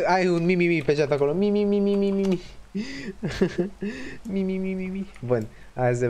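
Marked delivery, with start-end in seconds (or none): 1.3-2.4 high-pitched teasing "mi mi mi"; mocking mi-mi-mi. Streamer teasing the chat, mocking high mi-mi-mi. 4.3-4.9 high teasing "mi mi mi"; playful run of squeaked mi's; mocking mi-mi-mi. Streamer teasing after laughing, high mocking mi's.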